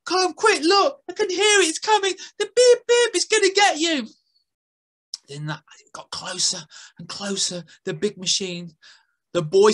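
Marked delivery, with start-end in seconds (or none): 0.0-4.1 put-on high, frantic girl pleading; girl begging the boy to save the squirrel. A narrator's reading. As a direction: beg him to hurry. Girl character's voice.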